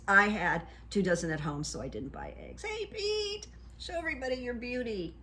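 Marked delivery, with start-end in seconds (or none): 2.6-5.1 high-pitched, soft call to a cat; sing-song pet-calling voice, softly. Real, not acted. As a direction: coo high and quick to your cat. Calls to the cat on camera.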